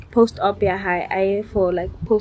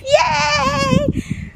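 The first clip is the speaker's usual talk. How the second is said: much higher squealed yay; excited cheer. Real, not acted. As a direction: squeal of delight. Cheering her new vlog.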